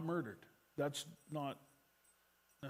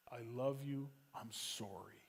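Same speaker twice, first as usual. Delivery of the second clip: soft apology; 4 semitones lower, 9 dB quieter than usual. Retold or imitated. Peter's apology to Jesus.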